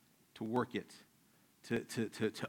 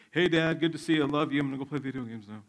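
loud, dutiful greeting, fading off quickly; forced greeting; 3 semitones higher, 7 dB louder, faster than usual. Retold or imitated. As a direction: imitate kids obliged to greet dad. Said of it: "It'd be forced".

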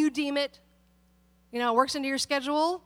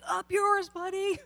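raised pitch, snide mock retort; mock rude brush-off; 6 semitones higher than usual. Churchgoer mocking a rude driver, snide and high. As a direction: toss off a cheeky fake insult. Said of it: "get in your car and be like".

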